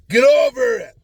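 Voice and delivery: loud, high shout; emphatic call-out. Shown as a demonstration. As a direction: holler it out like a blunt order. Announcing the day's topic as command.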